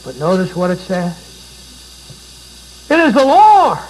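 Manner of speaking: loud, high, ringing sermon climax; full-voiced pulpit preaching; preaching at full force. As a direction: preach it out to the back row.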